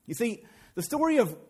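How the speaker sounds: normal talking voice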